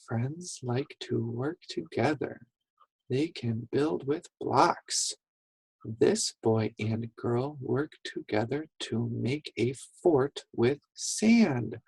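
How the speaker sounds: soft, even prompting read; quiet read-along. Shown as a demonstration. Teacher listens while you read, quiet read-along. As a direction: hold back so the children's voices lead. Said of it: "I'll listen. You read".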